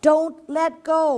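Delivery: warning. A narrator's reading. Mother frog warning the family, slow and loud. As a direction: call the warning out slowly. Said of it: "warned Mother".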